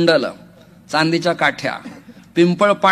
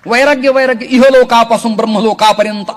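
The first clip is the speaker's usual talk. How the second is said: preaching at full force; 7 semitones higher, 8 dB louder than usual. Acted. Kirtankar preaching to the gathered devotees, high and loud. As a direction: lift the line out to the whole crowd.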